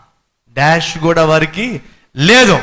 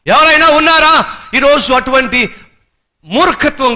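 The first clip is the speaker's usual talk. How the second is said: preaching shout; 8 semitones higher than usual. Real, not acted. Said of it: "preaching challenge".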